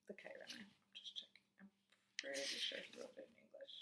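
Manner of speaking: muttering to self. Real, not acted. Muttering while checking the book.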